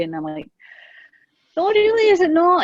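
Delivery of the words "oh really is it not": high, loud put-on surprise, all innocence; mock surprise. Mum playing innocent to her arguing son, mock surprised. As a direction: play dumb with big bright astonishment.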